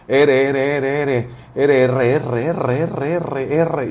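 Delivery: "R" repeated over and over, working toward a trill; practising the R. Retold or imitated. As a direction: drill "R" like a child teaching himself to roll it. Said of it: "entonces empecé R". ("R" repetida como ejercicio hasta vibrarla)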